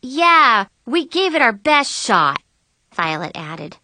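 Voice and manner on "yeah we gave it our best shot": loud, high, upbeat agreement; rally a downhearted friend; emphatic, higher-pitched reassurance; encouraging. A narrator's reading. Violet cheers up her friend.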